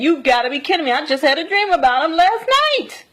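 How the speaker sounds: rising high, astonished retelling; incredulous reply. Retold or imitated. Telling a friend about last night's dream, incredulous. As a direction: build in pitch to an exclaimed disbelief.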